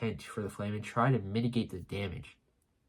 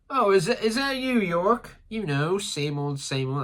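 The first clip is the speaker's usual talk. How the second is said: high-pitched, hammed-up British accent; 8 semitones higher, 4 dB louder than usual. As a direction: put on a chipper British voice, pitched up. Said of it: "Vegapunk has a British accent now".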